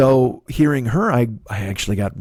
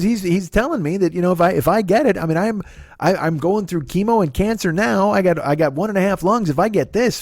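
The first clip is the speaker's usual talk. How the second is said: higher mom voice throughout; imitating mom; 8 semitones higher than usual. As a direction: keep it up high as mom explaining. Mom voiced retelling doctor.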